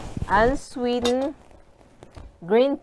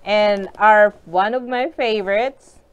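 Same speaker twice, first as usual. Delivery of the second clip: ordinary talk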